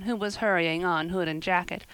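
usual speaking voice

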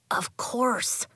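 breathy hushed aside; whispering. Acted. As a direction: whisper it to a friend. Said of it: "Sebastian whispered to Elliot".

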